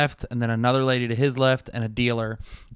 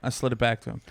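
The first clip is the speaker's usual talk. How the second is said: ordinary talk, nothing marked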